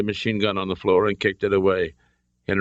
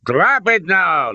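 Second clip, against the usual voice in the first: high, slow, forceful order; demanding; 12 semitones higher, 5 dB louder, slower than usual. A narrator's reading. Colonel orders Indy to disarm, demanding shout. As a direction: bark it as a drawn-out command. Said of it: "the colonel demanded".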